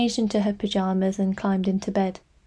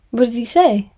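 eager, raised, rising question; 3 semitones higher, 6 dB louder than usual. A narrator's reading. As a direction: ask it keenly, voice lifting. Mother eager for memory.

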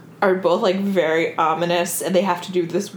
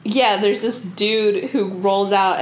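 ordinary talk

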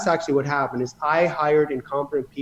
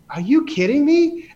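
high incredulous retort, a touch louder; shocked disbelief; 10 semitones higher, 3 dB louder than usual. Retold or imitated. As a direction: snap back in stunned disbelief. Retelling shocked reply to bookkeeper.

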